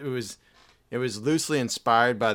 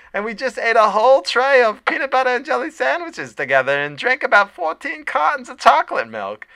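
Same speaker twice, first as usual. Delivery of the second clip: very high, louder doting lunch lady; 9 semitones higher, 5 dB louder than usual. Pretend. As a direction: coo at him like an adoring cafeteria lady. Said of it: "Squeezer's Lunch Lady live".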